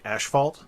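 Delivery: "asphalt" said as ash-fault; own odd pronunciation. Shown as a demonstration. Speaker whose wife makes fun of the word, odd pronunciation. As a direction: put a sh sound in "asphalt", slowly. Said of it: "makes fun of me because I".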